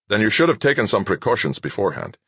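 quick irritated retort, fast; snapped. A narrator's reading. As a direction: snap it back at them, impatient. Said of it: "Doctor Montana snapped".

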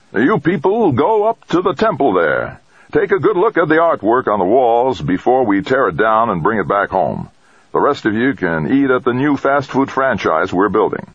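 loud, high crowd-marshalling call; shouted orders. Acted. Man directing a crowd in dream.

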